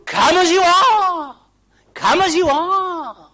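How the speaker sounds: slow, high, roaring invitation; full-force shout. Real, not acted. Preacher answering the helpless seeker, long high shout. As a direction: bellow it out, stretching each phrase.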